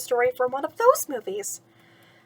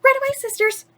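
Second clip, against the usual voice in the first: much higher, bright singsong reply; cheerful singsong; 12 semitones higher, 5 dB louder than usual. Acted. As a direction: chirp it back sweetly. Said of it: "Cinderella sang out".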